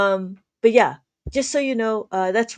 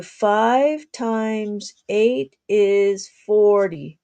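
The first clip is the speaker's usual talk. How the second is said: slow teaching; much slower than usual. Shown as a demonstration. Teacher demonstrating a sum.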